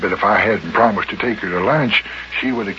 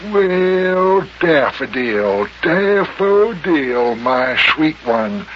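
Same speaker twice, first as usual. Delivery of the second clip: mock sweet-talk; 6 semitones higher, much slower than usual.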